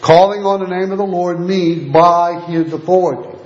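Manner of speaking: ordinary delivery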